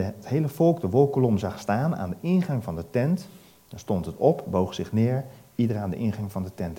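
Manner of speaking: ordinary talk, nothing marked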